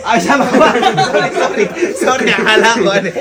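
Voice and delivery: loud, high, laughing sorry; laughing apology. Real, not acted. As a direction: apologise loudly through a laughing fit. Laughing apology to Bok at parting. (tumatawang paumanhin)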